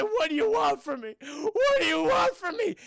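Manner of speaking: high, loud begging that climbs into a cry; terrified pleading cry. Retold or imitated. Conway begs the crocodile monster, rising to a loud terrified cry.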